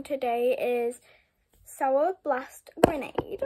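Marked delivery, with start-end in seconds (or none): 1.6-3.4 hushed whisper, slow. Real, not acted. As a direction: whisper the name slowly. Naming candy in a whisper.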